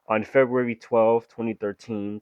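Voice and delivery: normal talking voice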